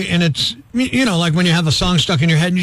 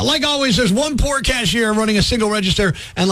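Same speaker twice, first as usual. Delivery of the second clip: ordinary talk, nothing marked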